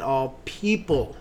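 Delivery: "people" drawn out and set apart; stress on people. Shown as a demonstration. Co-host quoting a famous line, stressing "people". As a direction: lean on "people" as the important term. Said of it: "Important term".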